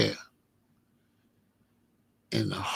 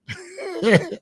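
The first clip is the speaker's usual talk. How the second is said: a sudden high cackle; high laugh. Real, not acted. Laughing about being made vice lord, high laugh. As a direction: crack up at it. Laughing at his own story.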